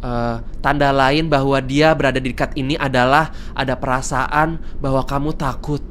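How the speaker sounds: low, hushed lore reading; spooky story narration. Acted. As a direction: read the legend like a campfire tale. Reads creepy lore. (narasi dongeng seram, rendah dan pelan)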